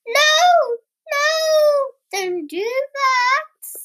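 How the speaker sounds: high, loud drawn-out protest; alarmed pleading. A narrator's reading. All the animals beg Mummy Frog, high alarmed wail. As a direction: cry out to stop her.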